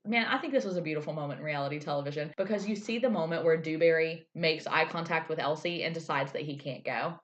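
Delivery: ordinary delivery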